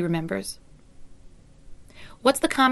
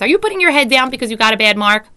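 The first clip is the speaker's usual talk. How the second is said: loud accusing question; harsh I tone; 3 semitones higher, 10 dB louder than usual. Shown as a demonstration. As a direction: demand it sharply, loud. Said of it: "teacher uses an I tone".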